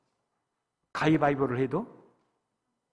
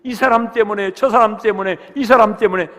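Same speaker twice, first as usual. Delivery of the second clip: mock excuses; 5 semitones higher, 12 dB louder than usual. Mocking excuse makers.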